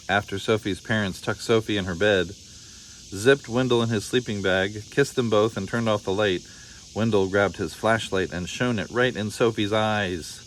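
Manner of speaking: ordinary delivery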